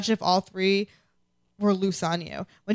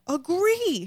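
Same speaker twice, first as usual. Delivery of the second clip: mock emphatic agree; 9 semitones higher than usual. Cohost echoing her own agreement, high and playful. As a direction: Agree said much higher, sing-song.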